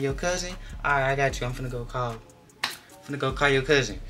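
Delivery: a hint of the nurse, up high; mock nurse voice. Nurse voiced in retold story.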